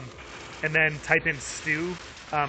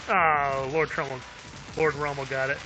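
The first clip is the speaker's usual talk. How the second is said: ordinary talk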